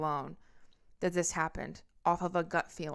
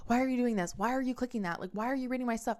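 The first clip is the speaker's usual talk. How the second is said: higher-pitched, mock panicked questioning; retold flustered protest, pitched up; imitating panic; 6 semitones higher, faster than usual. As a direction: fire off questions like a panicking boyfriend. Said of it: "he fucking panics".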